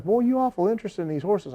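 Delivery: promoter's voice. Retold or imitated.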